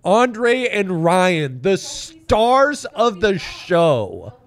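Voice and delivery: high, loud, slow mock announcer. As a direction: hype them up like stars. Mock introducing hosts as stars.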